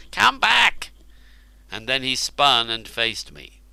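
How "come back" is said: high, loud desperate scream. A narrator's reading. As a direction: yell after the fleeing guards. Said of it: "screamed Cernus".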